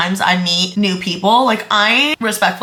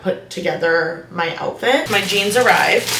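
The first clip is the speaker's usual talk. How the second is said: ordinary talk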